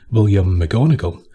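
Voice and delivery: plain reading voice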